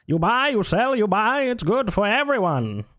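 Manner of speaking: high, slick pitchman voice; fifties salesman voice. Pretend. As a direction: sell it like a fifties ad man. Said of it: "Like a fifties salesman voice".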